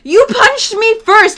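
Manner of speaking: high, loud, drawn-out protest; imitated accusing yell. Drunk boyfriend accusing girlfriend, imitated indignant yell. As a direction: throw the blame back, mock outraged.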